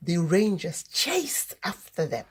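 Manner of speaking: ordinary delivery, nothing marked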